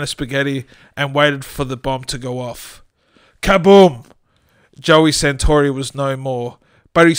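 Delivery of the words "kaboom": louder, higher explosion shout; loud boom. A narrator's reading. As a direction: burst out the kaboom. Bomb explodes.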